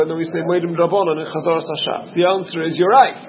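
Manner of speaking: usual speaking voice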